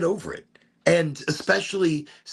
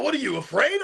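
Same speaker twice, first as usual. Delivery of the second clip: taunting yell; 11 semitones higher than usual. Retold or imitated. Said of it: "He goes".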